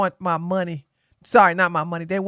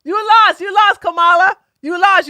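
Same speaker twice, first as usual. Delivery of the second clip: loud, higher gloating taunts; gloating shout; 9 semitones higher, 6 dB louder than usual. Real, not acted. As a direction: yell it in her face, triumphant. Taunting the Kamala TTS.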